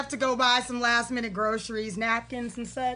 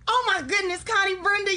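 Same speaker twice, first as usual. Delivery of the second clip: ordinary talk, nothing marked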